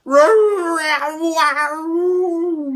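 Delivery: high, loud caterwauling; cat yowl. Acted. Cat caterwauls from the tree, high and loud. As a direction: wail like a cat. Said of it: "said Harry McCleary".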